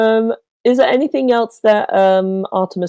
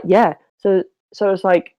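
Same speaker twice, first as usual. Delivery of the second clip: usual speaking voice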